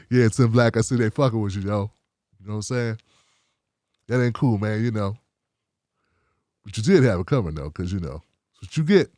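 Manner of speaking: low, casual mock viewer voice. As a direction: drop low and easy, a fan ribbing him gently. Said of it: "I hear these voices".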